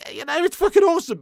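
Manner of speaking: high, loud burst of enthusiasm; excited. Real, not acted. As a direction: hype it up for the chat. Hyped about his community.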